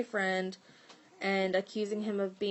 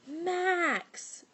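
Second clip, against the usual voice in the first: friend yelling a name; 10 semitones higher than usual. Retold or imitated. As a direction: shout the name across the yard. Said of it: "my friend yelled".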